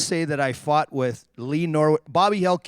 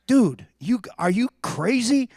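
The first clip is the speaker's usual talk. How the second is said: higher-pitched mock disbelief; retold incredulity; 6 semitones higher than usual. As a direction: question a buddy's sanity. Retold disbelief at teammate's remark.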